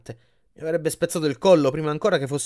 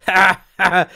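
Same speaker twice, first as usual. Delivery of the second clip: loud, higher-pitched acted laugh; 5 semitones higher, 9 dB louder than usual. A narrator's reading. The giant salesman laughs at his customer's remark, laughing. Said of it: "Krusty rise".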